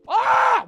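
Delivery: loud, high scream of frustration; frustrated scream. Real, not acted. Streamer losing patience with digging, sharp frustrated scream. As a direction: let out a sharp angry yell.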